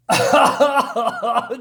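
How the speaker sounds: bursts out laughing high and loud; a real, high cackle at the joke. Real, not acted.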